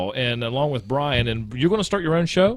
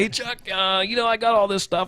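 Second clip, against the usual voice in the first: lightly voiced caller, higher pitched; imitating a caller; 8 semitones higher than usual. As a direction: pitch it up as a friend phoning for help. Acting out his friend's imagined call.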